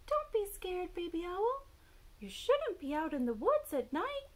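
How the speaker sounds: high squeaky bat voice; high-pitched storybook bat; bat character. A narrator's reading. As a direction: warn from the top of your range. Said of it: "said Bat".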